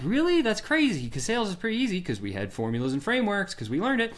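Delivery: hammed-up surprised disbelief; mock surprise. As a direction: play up fake astonishment that selling is hard.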